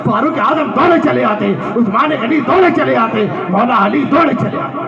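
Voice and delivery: rhythmic sermon build, rising to a high climax; impassioned preaching. Acted. Preacher retelling Bilal's answer about two kinds of slavery, impassioned. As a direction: drive each repeated phrase harder, building steadily.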